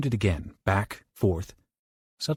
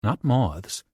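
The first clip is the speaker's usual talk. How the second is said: ordinary delivery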